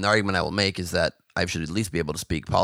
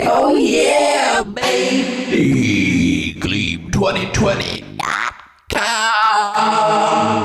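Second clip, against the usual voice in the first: sultry, drawn-out campaign ad, sung slow; sexy ad voice; 12 semitones higher, 6 dB louder, much slower than usual. Acted. Comedian croons his campaign ad to listeners. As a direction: croon the web address like a seduction. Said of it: "that message was sexy as hell".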